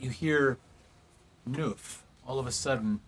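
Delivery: ordinary delivery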